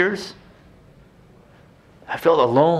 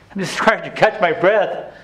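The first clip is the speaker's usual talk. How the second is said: ordinary talk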